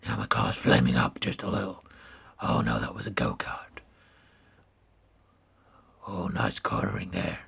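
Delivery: deep Batman growl; gravelly Batman voice. Pretend. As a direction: talk like Batman. Batman voice held.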